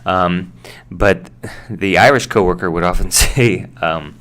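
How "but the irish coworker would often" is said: normal talking voice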